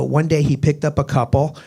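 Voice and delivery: usual speaking voice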